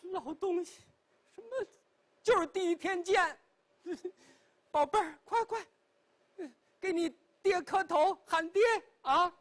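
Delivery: put-on high-pitched, eager old woman pushing the boy; an old mother high and excited, ordering her son to kowtow to his father; excited old lady. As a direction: hurry him along, thrilled and insistent.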